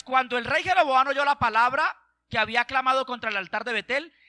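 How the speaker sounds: ordinary talk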